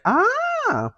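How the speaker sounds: high squeaky "Ah" of sudden realization; a short, very high "Ah", as if it has just dawned on you; surprised gasp. Retold or imitated. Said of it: "This was like".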